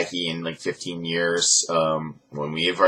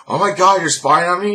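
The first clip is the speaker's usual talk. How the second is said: high, louder outraged whine; indignant wife; 10 semitones higher, 5 dB louder than usual. Retold or imitated. To Daniel about the bank alert, high indignant whine. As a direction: act out the wife catching him spying.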